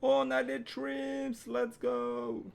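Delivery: high, loud, drawn-out celebration; slow, high victory yell; triumphant cheer. Real, not acted. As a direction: stretch it out in a jubilant shout. Celebrates finding trims.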